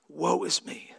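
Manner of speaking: breathless whisper of despair; whispered lament; whispered woe. Acted. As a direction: whisper it, undone.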